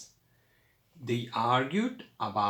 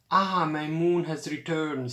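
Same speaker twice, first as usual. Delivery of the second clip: ordinary delivery, nothing marked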